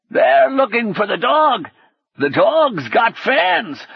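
put-on high, cracked old man's cackling voice. A narrator's reading. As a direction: guffaw it out like a cracked old man. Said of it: "guffawed in the cracked voice of an old man".

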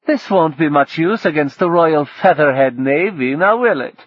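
a mocking announcement; William, wry. William greets the approaching navy, high and loud.

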